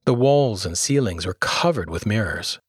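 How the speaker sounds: usual speaking voice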